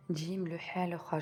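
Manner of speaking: usual speaking voice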